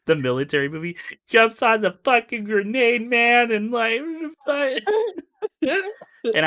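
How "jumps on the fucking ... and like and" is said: cracking up mid-sentence; breaking into laughter; laughing hard. Real, not acted. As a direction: voice climbing high and loud through laughter. Laughing while retelling joke.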